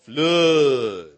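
Flood drawn out low as "Floood"; a long, low Texan "Flood"; Texan drawl. Shown as a demonstration. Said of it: "We say".

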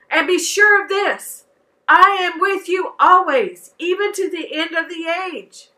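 bold and sure, well above a plain read; with confidence. Shown as a demonstration. Children's leader leads the kids in the verse, confident and bold. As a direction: declare it like you know it's true. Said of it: "say it with confidence".

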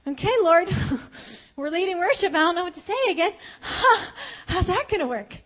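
high, loud, flustered rush with a nervous laugh; panicked. Retold or imitated. Worship leader telling the Lord she has no words, panicked and loud. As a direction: sound overwhelmed and scrambling for words.

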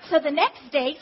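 ordinary delivery

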